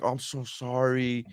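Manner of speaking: imitating a slow, soft, apologetic wince; wincing apology. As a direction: draw out a softly pained apology, mimicking her. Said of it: "she was, like, wincing".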